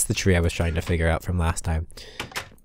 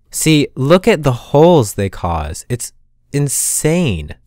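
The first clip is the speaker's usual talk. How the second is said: exasperated; 7 semitones higher, 5 dB louder, slower than usual. Real, not acted. Angry at creeper holes.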